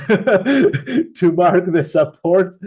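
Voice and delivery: slow words broken by a chuckle; laughing. Real, not acted. To a viewer's basic question, amused laughing. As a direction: laugh it off as obvious. Viewer asks how to mark support.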